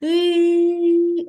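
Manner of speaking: teacher sounding the last vowel; sound demo. Shown as a demonstration. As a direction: call out the vowel "И" clearly. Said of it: "І останній звук".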